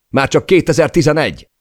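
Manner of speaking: high, loud alarmed call; shouted countdown. A narrator's reading. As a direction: cry out the falling altitude. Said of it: "kiáltotta Per". (riadtan kikiáltott magasságadat)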